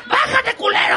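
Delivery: a road-rage scream at the driver, hammed up; furious shout. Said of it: "me decía". (grito furioso de la señora enojada)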